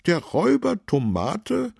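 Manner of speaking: very high, loud, incredulous question; astonished question. A narrator's reading. Councillor astonished to hear of the robber, surprised question. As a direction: echo it in high-voiced amazement. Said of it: "fragte Fabian Pix erstaunt".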